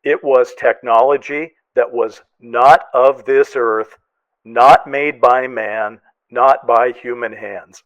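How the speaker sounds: slowed, each not pressed hard; solemn reading of a quoted line; reading with slow emphasis. Retold or imitated. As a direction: lean on each not, slowly. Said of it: "the line that Ross said earlier".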